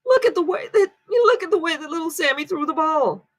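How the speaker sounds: put-on toddler talk. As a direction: coo like doting over a little kid. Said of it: "the way you would speak about a toddler".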